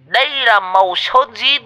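put-on old drunk selling a coffin like a showman; mock ceremonial speech. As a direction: ham up a grand salesman's pitch.

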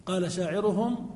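ordinary talk, nothing marked

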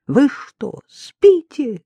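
high and loud, frantic reproach; desperate shout. Acted. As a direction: hurl it at him in anguish. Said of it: "крикнула она".

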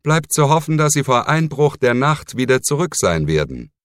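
a sour, grim hope. Acted. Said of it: "blickte überaus grimmig drein". (grimmig und verdrossen)

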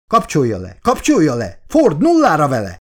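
building, high-pitched frantic orders; shrieking. A narrator's reading. Doctor shrieks at her colleague to switch it off, frantic. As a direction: start urgent and climb to a loud cry. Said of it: "visított már Elizabeth is".

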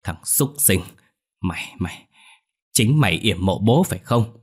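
understated angry accusation, through the teeth; menacing growl. A narrator's reading. Older brother confronting younger over father's grave, growling. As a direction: grind out the accusation. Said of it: "rồi gằn giọng".

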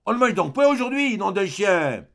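loud, high-pitched impatient yell; cursing shout, loud and high; shouting up the stairwell. A narrator's reading. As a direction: holler through the house for the meal. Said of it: "crier dans l'escalier".

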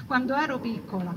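ordinary talk, nothing marked

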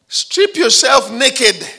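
high, loud, very slow demand; slow loud command. Retold or imitated. Devil's voice ordering the student, slow loud command.

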